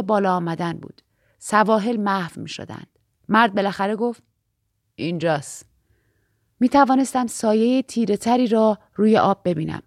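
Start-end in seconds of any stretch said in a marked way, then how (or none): 4.9-5.7 low, soft announcement; lightly voiced boatman, hushed. A narrator's reading.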